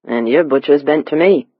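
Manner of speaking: put-on confident Cockney accent; East End dialect. A narrator's reading. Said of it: "speaking the East End dialect with such confidence".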